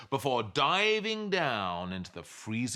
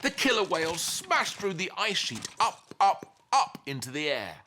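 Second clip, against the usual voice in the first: higher, building up, up; rising excitement; 8 semitones higher, 4 dB louder than usual. A narrator's reading. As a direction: climb with each up. Whales burst upward.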